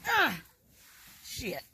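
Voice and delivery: normal talking voice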